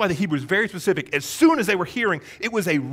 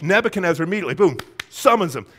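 usual speaking voice

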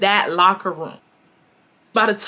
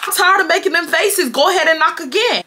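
exasperated; 8 semitones higher than usual. Retold or imitated. Frustrated aside to friend, high.

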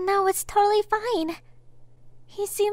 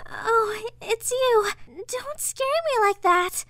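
shaken relief; 6 semitones higher, 4 dB louder, much slower than usual. Acted. Recovering from a scare.

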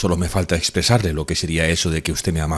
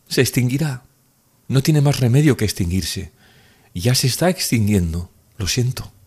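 put-on higher young woman's voice, mounting feeling; pitched-up, heartfelt young woman; Nastenka, emotional declaration; 4 semitones higher than usual. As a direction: confess love, voice catching. Nastenka declares her love.

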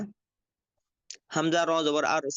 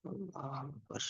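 distant background call; 2 semitones lower, 16 dB quieter, slower than usual. Real, not acted.

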